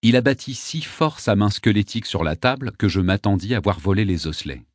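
usual speaking voice

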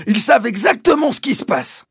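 very high, loud character voice; emphatic doctor. Acted. Doctor confirming the father's claim, high and emphatic.

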